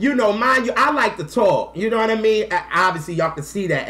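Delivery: normal talking voice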